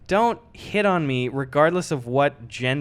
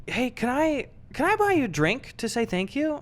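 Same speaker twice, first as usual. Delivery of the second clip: higher, eager hopeful offer; 8 semitones higher, 4 dB quieter than usual. Retold or imitated. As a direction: try to get a date. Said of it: "he goes".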